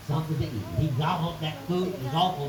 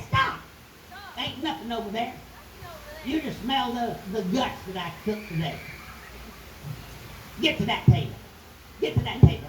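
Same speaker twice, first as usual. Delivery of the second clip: bossy woman voice; 5 semitones higher than usual. Acted. Wife stops the giant.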